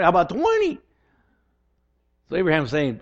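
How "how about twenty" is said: pleading bargain. Retold or imitated. Abraham bargaining with God.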